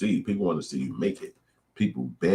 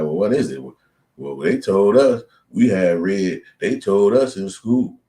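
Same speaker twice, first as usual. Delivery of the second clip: mock pleading defense; 5 semitones higher, 8 dB louder, slower than usual. Acted quote, naive defense.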